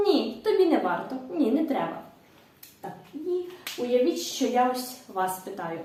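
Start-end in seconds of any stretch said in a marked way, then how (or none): none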